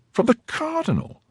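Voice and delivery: high, shocked wife's question; aghast at where the money came from. Acted. Wife shocked at money's source.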